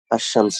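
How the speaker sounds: "আশ-শামস" with doubled sh instead of l; silent lam. Shown as a demonstration. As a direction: hold the sh in "আশ-শামস". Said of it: "আল উচ্চারণ না হয়ে".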